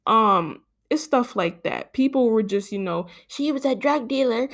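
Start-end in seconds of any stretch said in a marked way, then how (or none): 3.3-4.5 higher, sneering mock voice; mocking commenter. Mocking online commenters, sneering voice. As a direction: parody the know-it-all.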